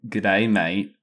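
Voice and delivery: a hesitant, put-on Australian accent. Guest pushed by the host into an Australian accent. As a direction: toss off Australian slang in a half-hearted Australian accent. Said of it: "do a, an Australian accent right now".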